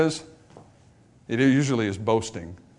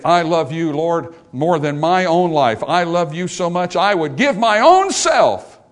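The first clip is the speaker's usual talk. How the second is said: boastful declaration; 3 semitones higher, 5 dB louder, slower than usual. Retold or imitated. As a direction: brag of devotion, building to a showy peak. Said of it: "to insincerely boast".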